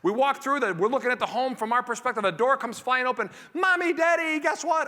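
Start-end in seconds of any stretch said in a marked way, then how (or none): 3.5-4.9 put-on high, loud kid voice bursting in; a little kid calling out for mom and dad; excited child. Child bursting through the door to parents, excited.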